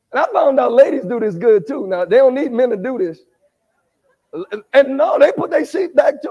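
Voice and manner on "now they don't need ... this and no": ordinary talk, nothing marked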